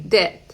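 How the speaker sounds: "dead" with its end stopped, unreleased; held final consonant. Shown as a demonstration. Teacher giving another example, held final stop. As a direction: cut "dead" short with no release. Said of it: "Esa T prácticamente no suena".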